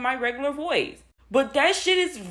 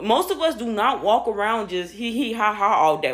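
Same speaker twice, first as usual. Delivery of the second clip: usual speaking voice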